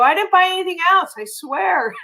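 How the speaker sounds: high, fast pleading denial; hammed-up I swear to my husband; playful guilty plea; mock innocent protest. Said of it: "I go".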